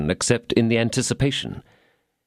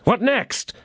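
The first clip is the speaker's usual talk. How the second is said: second 'What next?' pitched much higher; impatient cry. A narrator's reading. D'Artagnan urging the host on, crying out. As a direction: cry it out the second time, pressing hard. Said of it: "cried D'Artagnan".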